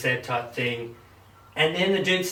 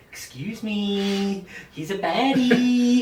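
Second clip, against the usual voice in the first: prim mock lawyer; 6 semitones higher, much slower than usual. Lawyer voiced in retold story.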